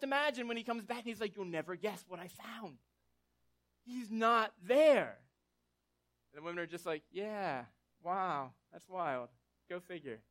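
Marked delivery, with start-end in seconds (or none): 1.4-5.2 slow, loud drawn-out reveal; dramatic reveal. Retold or imitated. Peter returning to the women, slow loud reveal. Peter reports tomb empty. 7.1-10.2 dry sarcasm. Retold or imitated. Women unimpressed, knew already.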